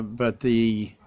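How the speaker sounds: ordinary talk, nothing marked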